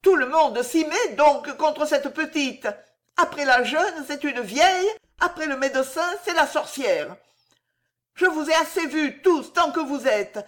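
high, loud furious outburst; high loud angry cry, building at the end; shouting. Acted. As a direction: drive them all out of the house. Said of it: "cria en le regardant".

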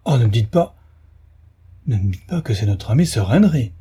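dramatized dismay, swooping pitch; alarmed pleading. A narrator's reading. Stapleton fearing the body is his friend's, alarmed plea. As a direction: plead against dreadful news. Stapleton fears it is Sir Henry.

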